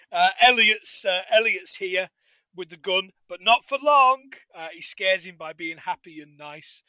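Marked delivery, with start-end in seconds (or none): none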